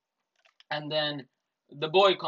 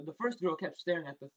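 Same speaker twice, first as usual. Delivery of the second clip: usual speaking voice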